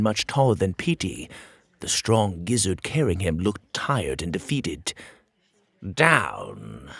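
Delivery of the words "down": snarled. Acted. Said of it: "snarled the king".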